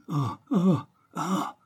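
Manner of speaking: high-pitched acted moans; distinct moans. A narrator's reading. Hidden captive answers the detective's call, distinct moaning. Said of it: "fit plus distinctement une voix humaine".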